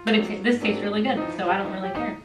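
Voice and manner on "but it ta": usual speaking voice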